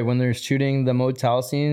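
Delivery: usual speaking voice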